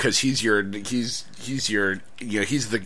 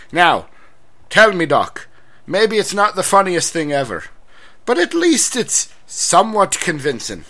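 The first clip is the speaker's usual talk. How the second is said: put-on Irish accent; 4 semitones higher than usual. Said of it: "Let me get into character".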